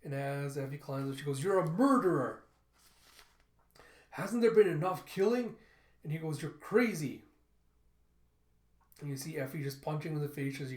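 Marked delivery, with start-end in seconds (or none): 1.4-5.6 high, loud outraged shout; heated, raised-voice outcry; angry accusation. Acted. Effie accuses killer mid-fight. 6.4-7.2 loud, raised comeback; acted fight-scene yell; heated retort. Attacker retorts mid-fight.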